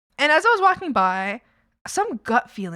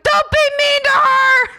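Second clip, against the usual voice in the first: much higher, louder, slow yell; yelled out the window; 9 dB louder than usual. Retold or imitated. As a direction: call it out of the car as you drive off. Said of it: "I just yelled out the window".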